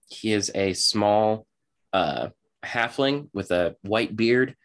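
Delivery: ordinary talk, nothing marked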